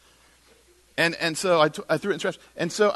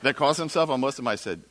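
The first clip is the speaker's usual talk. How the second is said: ordinary talk, nothing marked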